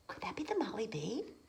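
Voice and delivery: whisper. Real, not acted. Aside to child listener.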